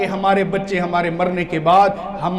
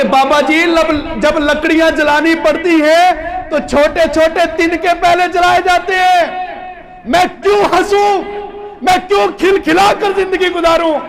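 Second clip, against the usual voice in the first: put-on high, loud child's answer, nearly shouting; impassioned plea; 10 semitones higher, 5 dB louder than usual. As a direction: cry out the child's reply with fervour. Child answering the imam passionately.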